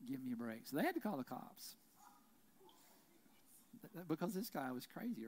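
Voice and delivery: ordinary talk, nothing marked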